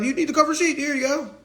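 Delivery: high, loud mocking clerk voice; sing-song mock of the courts. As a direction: hand it over like a smug clerk. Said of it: "They be like".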